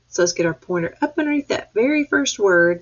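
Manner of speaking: normal talking voice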